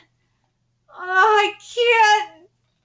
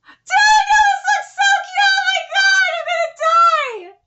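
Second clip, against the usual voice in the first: much higher, louder squeal; excited fan scream; 11 semitones higher, 4 dB louder than usual. Real, not acted. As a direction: shriek with overwhelmed delight. Fan screaming at cute art.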